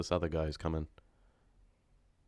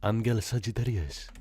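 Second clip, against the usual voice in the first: the name read with a Spanish accent; put-on Spanish accent; Spanish pronunciation; 4 semitones higher than usual. Shown as a demonstration. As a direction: say it as a Spanish name, a bit higher. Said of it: "unless she's Spanish".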